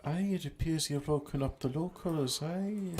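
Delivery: a jokey Highland laird; gruff scottish lord. Pretend. Plays a Scottish local.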